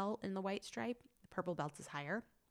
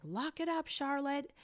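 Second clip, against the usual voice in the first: high, raised coach's command; imitating tough coach; 9 semitones higher, 5 dB louder than usual. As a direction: mimic a karate coach toughening up a kid. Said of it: "make sure she stays tough".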